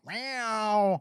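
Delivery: long, high mimicked meow; drawn-out loud cat yowl; imitated cat meow. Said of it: "it always starts with".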